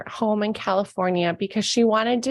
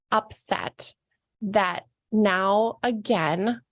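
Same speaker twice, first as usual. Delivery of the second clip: ordinary talk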